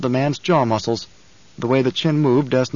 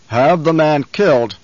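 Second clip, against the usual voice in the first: slow, loud, cold order; cold command; 3 semitones higher, 6 dB louder than usual. Acted. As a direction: order it coldly. Baron commands.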